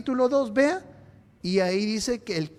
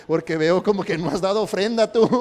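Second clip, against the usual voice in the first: laughing tease; 5 dB louder than usual. Acted. Teasing a grumpy neighbour.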